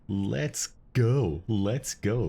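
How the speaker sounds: ordinary talk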